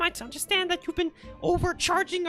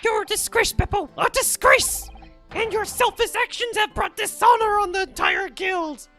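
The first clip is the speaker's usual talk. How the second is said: loud, furious dressing-down; angry scolding; 15 dB louder than usual. Acted. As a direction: berate him with rising anger. Guildmaster scolds coachman.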